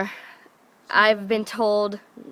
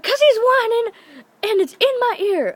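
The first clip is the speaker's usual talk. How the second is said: high, loud whiny complaint; imitating dad's exasperated yelling; 13 semitones higher, 5 dB louder than usual. Half-asleep dad complains about the dog, exasperated.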